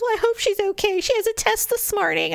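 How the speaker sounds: high-pitched mock tearful mother; imitated crying. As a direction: fret in a hammed-up weepy voice.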